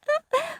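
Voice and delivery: put-on very high little-kid laugh; toddler giggle. A narrator's reading. Toddler giggles at his own joke, very high. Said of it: "Fudge laughed".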